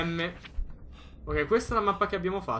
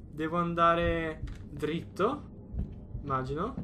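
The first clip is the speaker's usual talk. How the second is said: normal talking voice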